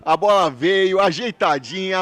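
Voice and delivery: ordinary talk, nothing marked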